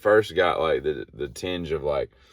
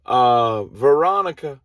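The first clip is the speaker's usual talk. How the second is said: high, loud call into a walkie-talkie; key up and call out loud; 9 semitones higher, 9 dB louder, slower than usual. Retold or imitated. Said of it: "she keys up, and she's like".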